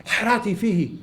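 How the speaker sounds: lifted, outward half-shout; almost shouted. Shown as a demonstration. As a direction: nearly shout it, held just short. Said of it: "You almost shout it".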